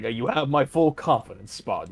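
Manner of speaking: lightly voiced polite butler; a hint of a respectable butler. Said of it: "sounds like a butler".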